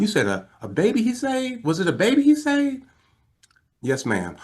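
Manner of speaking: very high imitation of a stunned woman; astonished questioning. Wife realizing who is calling, imitated astonishment. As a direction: question it in rising amazement.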